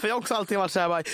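usual speaking voice

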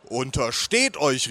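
slow, stressed warning; called-out warning. Acted. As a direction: draw it out as a threat. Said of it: "rief ich".

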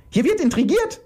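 very high-pitched screamed accusation; screaming. Acted. Iris accusing the others of intrigue, screaming. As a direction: screech it out high. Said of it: "schrie Iris".

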